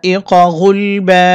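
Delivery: drawn-out chanted ওয়া ফাকিহাতাও; slow tajweed chant; slow recitation. Shown as a demonstration. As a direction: chant slowly with long vowels. Recites joined verse.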